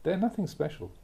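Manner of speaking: ordinary delivery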